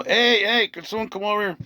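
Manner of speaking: retold call for someone to come, pitched high; calling for help. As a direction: call out to get the staff over.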